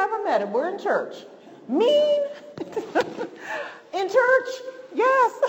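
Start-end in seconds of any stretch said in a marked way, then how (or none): 1.7-5.4 high, loud squeal of disbelief; mock disbelief. Preacher mocking shock at mean church people, squealing disbelief. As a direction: gasp out each word as if scandalized.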